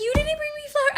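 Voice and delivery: loud, high-pitched accusation; retold complaint, voice way up; indignant. Woman accusing boyfriend over promised flowers, indignant.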